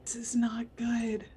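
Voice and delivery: worried whisper under the breath. Real, not acted. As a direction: mutter it to yourself in dread. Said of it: "whispered worry".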